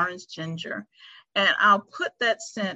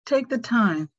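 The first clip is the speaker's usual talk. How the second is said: guided meditation. Acted. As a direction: ease them into stillness, unhurried. Leads a five-minute meditation.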